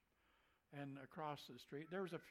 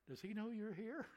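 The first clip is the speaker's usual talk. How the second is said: voiced higher, sly hinting question; knowing insinuation; 7 semitones higher, 5 dB louder than usual. Locals slyly hinting to the new preacher, higher. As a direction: let the hint land without saying it. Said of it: "what they was trying to say secretly".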